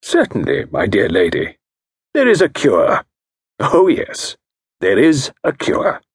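diagnose her case with calm authority; a genteel consultant voice; Parker Pyne. Acted.

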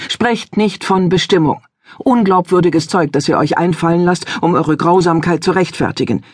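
father silences the stranger in anger; angry father. Acted. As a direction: cut him off and refuse.

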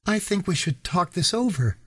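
calm the men down gently; mellow girl's voice. A narrator's reading. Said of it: "in a voice that was mellower than her appearance".

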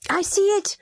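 put-on high, eager little boy's cry; a boy crying out that he sees the dragon; excited child cry. A narrator's reading. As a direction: cry out like a thrilled small boy. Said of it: "James cried".

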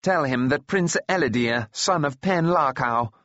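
lightly voiced imperious prince; haughty order. A narrator's reading. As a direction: announce yourself with self-importance. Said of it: "he ordered".

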